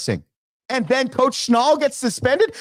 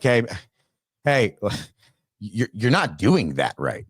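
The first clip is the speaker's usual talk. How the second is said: low mock boss; 12 semitones lower, 4 dB quieter than usual. Acting a boss in a meeting.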